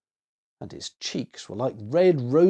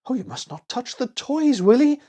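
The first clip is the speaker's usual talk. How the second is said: frightened gasp; 11 semitones higher, 3 dB louder than usual. A narrator's reading. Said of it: "gasped poor Annie in fright".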